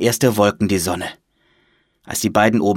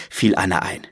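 ordinary delivery

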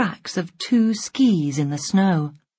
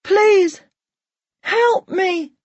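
high-pitched cry for help; scared; 13 semitones higher, slower than usual. A narrator's reading. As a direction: plead for rescue in a frightened, high voice. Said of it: "and he's scared".

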